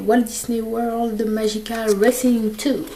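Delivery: slow, drawn-out English in a French accent. Real, not acted. Vlogger showing off a game title, French-accented English. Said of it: "Avec mon super accent".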